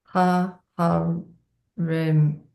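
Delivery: staccato, one syllable at a time. Shown as a demonstration. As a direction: chop it into separate syllables. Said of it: "read it syllable by syllable".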